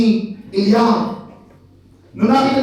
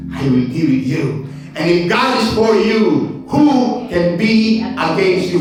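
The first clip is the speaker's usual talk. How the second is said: solemn scripture quote; 3 semitones lower, slower than usual. Acted. Quoting God from the pulpit.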